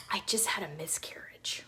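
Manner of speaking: whispered and low, barely there; hushed whisper. Retold or imitated. Retelling a stranger's painful confession, whispered low. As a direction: let the words fall out in a whisper.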